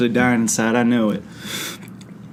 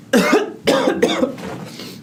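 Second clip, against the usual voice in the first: sharp coughing from the heat; high rasping cough; 13 semitones higher than usual. Real, not acted. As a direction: cough hard, throat on fire. Choking on spicy food.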